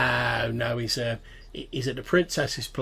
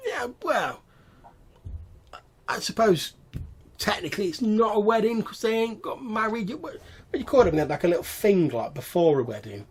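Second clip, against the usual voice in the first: high goblin voice, hesitantly explaining; pedantic goblin; 11 semitones higher, 3 dB louder than usual. Acted. Goblin searching for the name of a pre-wedding event, pedantic.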